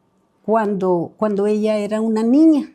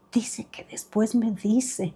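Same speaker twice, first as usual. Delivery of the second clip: slow, hushed, much softer aside; whispered reply; 6 dB quieter, slower than usual. Retold or imitated. Mother relaying her son's answer during mass, whispering. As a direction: whisper it so others cannot hear.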